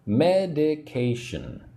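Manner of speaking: Medication said slowly with weight on ca; slow drilled Medication. Shown as a demonstration. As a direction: model Medication slowly for repeat. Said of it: "Say the word with me".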